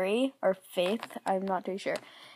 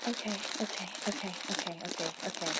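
self-soothing; 4 semitones lower, 10 dB quieter, faster than usual. Real, not acted. Talking herself down, fading.

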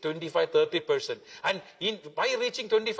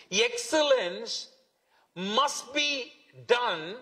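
slow emphasis; 2 semitones higher, much slower than usual. Acted. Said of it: "preacher stressing key word".

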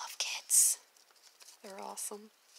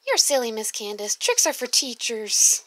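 put-on louder, slow, playful kid; child voice; 3 semitones higher, 10 dB louder than usual. Child teasing teacher, loud slow kid voice. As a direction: tease the teacher like a preschooler.

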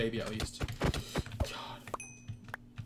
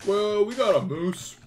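higher, louder, hammed-up showman voice; proud zoo owner; 6 semitones higher, 8 dB louder than usual. Player answers a pretend reporter about the zoo, hammed-up boast.

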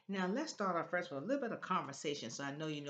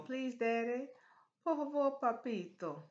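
high sing-song wheedle; mock child's plea; playful pleading; 7 semitones higher than usual. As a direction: coax daddy like a begging child. Acts out child begging daddy.

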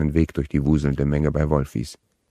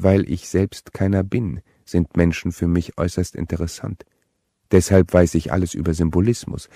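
ordinary delivery

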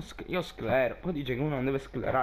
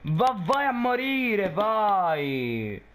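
angry yell; 5 semitones higher, much slower than usual. Real, not acted. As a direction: shout it at the screen. Cursing the enemy.

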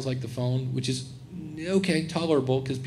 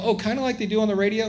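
higher, quick surprised question; questioning listener; 7 semitones higher, faster than usual. Retold or imitated. Audience member asking if it is like radio, higher and quick. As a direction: play the listener catching on mid-thought. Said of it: "and you go".

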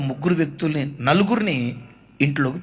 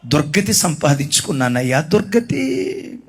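lamenting mother; 7 semitones higher than usual. Retold or imitated. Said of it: "ఏడుస్తుంది".

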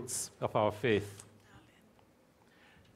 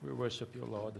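much softer, lower prayer; hushed worship; quiet prayer; 3 semitones lower, 11 dB quieter than usual. Real, not acted. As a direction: murmur it to God.

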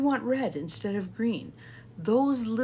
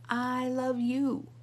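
high, slow and sing-out "I love you"; mirror affirmation; 5 semitones higher, slower than usual. Shown as a demonstration. Talking to yourself in the mirror, high slow affirmation. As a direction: draw it out on a raised pitch. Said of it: "Another one that you can do in the mirror".

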